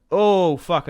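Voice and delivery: sudden curse. Real, not acted. Gamer cursing a misclick, startled and loud. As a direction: caught off guard by a misclick. Misclicks while offering alliance.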